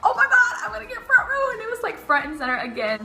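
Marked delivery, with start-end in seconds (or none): 0.1-1.5 high, fast and laughing; excited. Retold or imitated. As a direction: squeal it out fast with delight. Thrilled to get front row.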